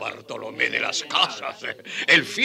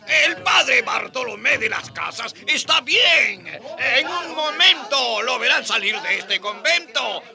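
calming a crowd; 8 semitones higher than usual. Acted. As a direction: call out over an unruly crowd. Friar calms an agitated crowd.

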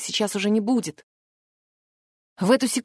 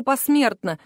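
ordinary delivery

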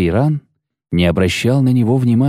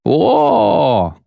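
high, drawn-out whoop; brash yell; 11 semitones higher than usual. A narrator's reading. Familiar cheeky voice bursting in, brash yell. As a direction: cry out in cheeky delight. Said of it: "завопил вдруг знакомый нахальный голос".

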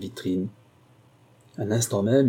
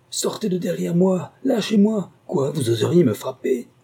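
high, loud panicked cry; a frightened old man yelling for his life; 8 semitones higher, 3 dB louder than usual. Acted. Old lord crying out at the woman behind him, high panicked yell. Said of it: "j'entendis le vieux crier".

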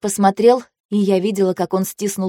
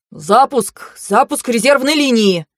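building shout, rising in pitch; urgent shouted launch command; yelled command; 5 semitones higher, slower than usual. A narrator's reading. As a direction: yell the order, higher on the last word. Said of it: "орали мы вместе".

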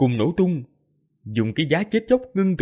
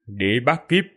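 cold and detached. Acted. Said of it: "Tô Minh lạnh nhạt nói".

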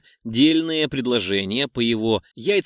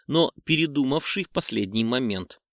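usual speaking voice

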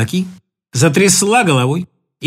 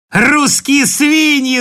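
slow, higher, drawn-out shout; 6 semitones higher, 4 dB louder, much slower than usual. Acted. Mainat shouting at the Russians. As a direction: shout the insult slowly. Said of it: "закричала она".

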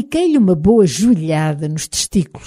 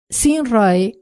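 a touch of annoyance, pitched up; slightly irritated; 5 semitones higher than usual. A narrator's reading. Hidden man answers his leader from the brush, slightly irritated. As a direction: answer a summons a little testily. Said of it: "um pouco irritada".

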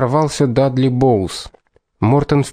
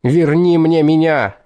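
slow, higher yelled plea; shouting; 5 semitones higher than usual. A narrator's reading. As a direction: bellow it, drawn out. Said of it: "заорал Дадли".

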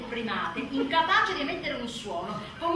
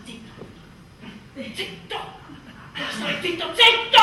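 building shush to yell; 4 semitones higher, 7 dB louder than usual. Retold or imitated. Wife silencing husband, building.

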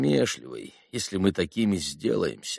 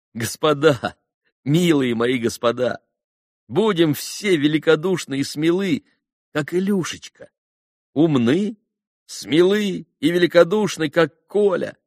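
high, impassioned oratory; rally them with a raised, ardent voice; fervent appeal; 7 semitones higher, 4 dB louder than usual. A narrator's reading. Алёша calls the dear boys to be generous and brave, fervent raised appeal.

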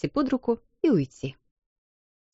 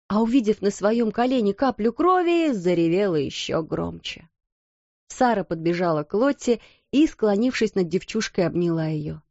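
ordinary delivery

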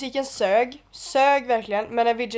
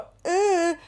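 higher, drawn-out mocking grunt; imitated sleazy "äh"; 8 semitones higher than usual. Friend imitating the guy she argued with, sleazy mock noise. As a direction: mimic a slimy guy's noise. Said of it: "han var så här en riktig så här slisk".

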